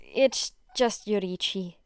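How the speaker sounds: usual speaking voice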